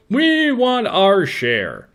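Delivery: high, slow, drawn-out demand; hammed-up chorus of claimants; clamoring group demand. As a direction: stretch each word out high, as the greedy family. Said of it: "each one is saying".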